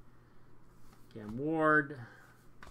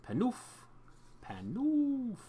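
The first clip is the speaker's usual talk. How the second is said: sing-song name; 11 semitones higher, slower than usual. Real, not acted.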